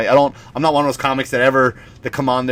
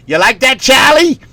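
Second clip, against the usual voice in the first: high, pitched-up Boston mother; Boston mom; 11 semitones higher, 4 dB louder than usual. Retold or imitated. Mom filming her son's Christmas present, high Boston voice. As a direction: imitate a Boston mom talking up a gift. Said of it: "the mom or the grandmother, whoever's videotaping".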